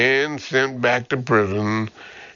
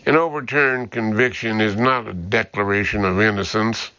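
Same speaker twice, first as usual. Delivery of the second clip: usual speaking voice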